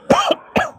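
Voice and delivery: dry cough fit; sharp cough from spicy sauce. Real, not acted. Choking on green sauce.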